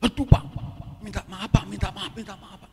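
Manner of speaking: seruan kaget tinggi, lalu maaf bertubi-tubi; panik minta maaf. Retold or imitated. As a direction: tirukan manajer yang panik. Manajer kaget melihat kecoak, minta maaf. (tiruan manajer panik meminta maaf)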